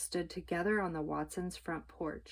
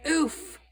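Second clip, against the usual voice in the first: landing grunt; 9 semitones higher, 7 dB louder than usual. A narrator's reading. Mercy lands.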